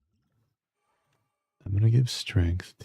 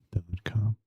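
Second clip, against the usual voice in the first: whispered ad tag; 6 dB quieter than usual. Acted. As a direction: drop to a whisper. Ad read ends in whisper.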